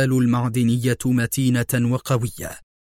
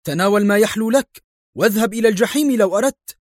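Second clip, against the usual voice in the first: much higher, fast furious yell; doctor screaming in the patient's face; 9 semitones higher, 4 dB louder, faster than usual. A narrator's reading. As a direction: blow up at him. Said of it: "صرخ الطبيب في وجهه".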